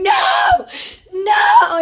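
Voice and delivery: high shrieking no, with a laugh in it; retold screaming. Mother under the covers screaming no, laughing and crying. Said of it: "she's screaming".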